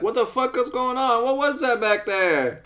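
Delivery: high-pitched, freaked-out imitation; mock panic. [name] asking Jax about the attack, mock panic. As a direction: blurt it high in alarmed confusion.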